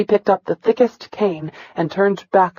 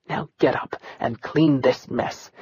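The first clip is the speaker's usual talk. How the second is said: slow, low, softer order; cold command; 5 semitones lower, 6 dB quieter than usual. Acted. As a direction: order him coldly, no need to shout. Commander orders the beaten boy.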